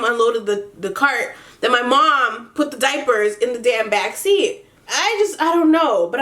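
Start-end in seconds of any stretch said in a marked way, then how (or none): none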